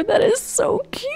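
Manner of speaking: high squeal of real delight. Real, not acted. Gamer squealing to viewers as her sims become girlfriends, delighted. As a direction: squeal it out, overcome with cuteness.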